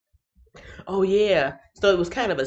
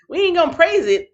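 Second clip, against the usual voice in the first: emphatic exclamation; 10 semitones higher, 7 dB louder than usual. Real, not acted. Correcting praise to press.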